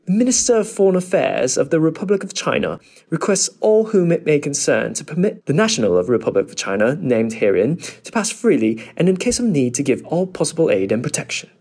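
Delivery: British accent demo. Shown as a demonstration. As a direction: read it out like a posh Brit. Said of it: "接下来是英式的口音".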